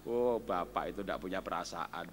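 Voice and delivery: low, soft mock sigh; low mock-sympathetic aside; mock sympathy. Real, not acted. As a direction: say it with fake pity.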